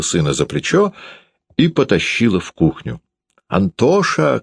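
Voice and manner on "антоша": high-pitched summoning cry; mother calling out. A narrator's reading. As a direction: call the child sharply, voice pitched up. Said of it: "кричала она".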